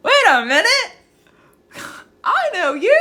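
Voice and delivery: high, loud cry of recognition; delighted surprise. Real, not acted. Streamer recognizing an old friend in chat, delighted surprise. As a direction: burst out as you spot a friend. Suddenly recognizes a viewer who joined.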